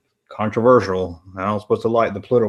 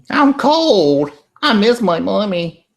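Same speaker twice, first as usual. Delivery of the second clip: put-on high, plaintive little-kid voice; homesick child; 9 semitones higher, 4 dB louder, slower than usual. Homesick kid complaining about missing mommy, plaintive. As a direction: whine it like a kid missing mom.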